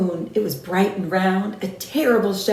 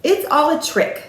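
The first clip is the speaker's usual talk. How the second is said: put-on child's certainty; 6 semitones higher than usual. Gruffalo's child declaring.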